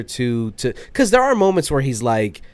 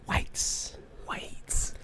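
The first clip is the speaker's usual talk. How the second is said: whispering; 13 dB quieter than usual. Real, not acted. Whispered aside.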